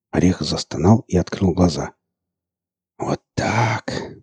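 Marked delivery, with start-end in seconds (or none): 3.0-4.2 soft whisper. Acted. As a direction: breathe it out quietly. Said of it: "прошептал Марк".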